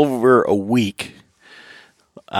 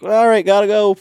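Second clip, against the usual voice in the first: hurried goodbye; 10 semitones higher, 3 dB louder than usual. Retold or imitated.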